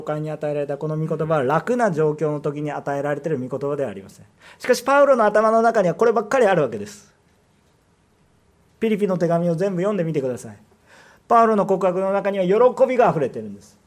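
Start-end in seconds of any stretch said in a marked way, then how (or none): 4.6-13.8 loud, driving sermon climax; rising fervour, every phrase louder; preaching at full force. Acted. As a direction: pound it home to the congregation.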